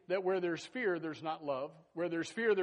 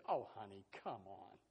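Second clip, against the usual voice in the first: lower, softer, drowsy brush-off; sleepy dismissal; 9 semitones lower, 8 dB quieter than usual. Acted. Husband in bed brushes off his wife's worry, soft and low. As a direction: mumble it half asleep.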